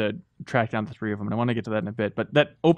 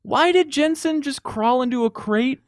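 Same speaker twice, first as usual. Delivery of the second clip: incredulous; 11 semitones higher, slower than usual. Real, not acted. Incredulous question.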